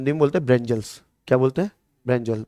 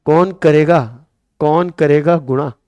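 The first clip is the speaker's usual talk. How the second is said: कौन करेगा slow and louder, leaning on कौन; stress on कौन करेगा; 4 dB louder, slower than usual. Shown as a demonstration. Teacher driving home the pun, slow and emphatic. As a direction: punch कौन and stretch करेगा. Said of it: "teacher stressing the pun".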